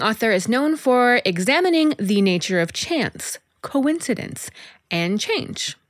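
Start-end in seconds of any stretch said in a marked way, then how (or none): none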